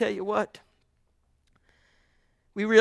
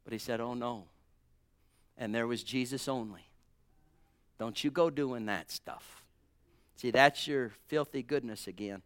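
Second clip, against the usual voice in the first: much lower and softer, almost to himself; hushed retelling; 8 semitones lower, 8 dB quieter than usual. Acted. Preacher softly recounting Jesus only on the mount, hushed. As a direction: draw the church in with a hush.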